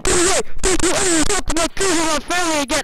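loud furious warning shout; angry yelling. Retold or imitated. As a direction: scream the warning at a stalker. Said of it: "I said, I said".

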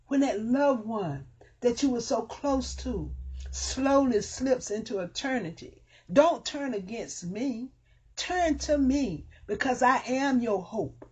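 high, insistent preaching plea; full-force preaching. Acted. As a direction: press the hope on the listeners. Preaching as God's voice.

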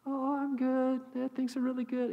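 high, bright "I'm fine" voice of a lady hiding her trouble; fake cheerful lady. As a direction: play a woman cheerfully lying that all is good. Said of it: "It was so clear she's lying".

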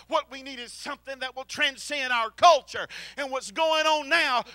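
ordinary delivery

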